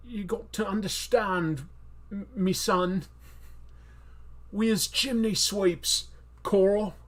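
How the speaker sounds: slow, badly faked accent; impression slipping into chimney-sweep accent; Rick from Walking Dead. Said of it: "I can't do impressions".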